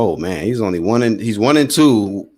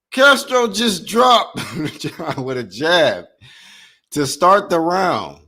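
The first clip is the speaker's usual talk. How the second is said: laughing; 7 semitones higher, much slower than usual. Real, not acted. Laughing over the knockdown.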